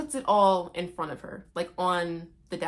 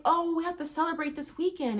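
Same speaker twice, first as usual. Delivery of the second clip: put-on girly daughter; 9 semitones higher, faster than usual. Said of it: "she's like".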